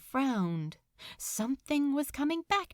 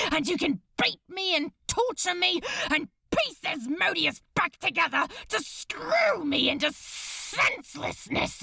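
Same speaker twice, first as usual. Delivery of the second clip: furious rage; 6 semitones higher than usual. Acted. Crowley raging at Beelzebub, furious shout. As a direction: spit it at him in fury. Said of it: "livid with rage".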